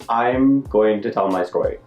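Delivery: ordinary talk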